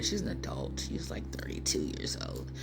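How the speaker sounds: a low confiding whisper; whispered aside. Real, not acted. Vlogger tells viewers her daughter's age, whispered aside. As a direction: drop to a whisper for this bit.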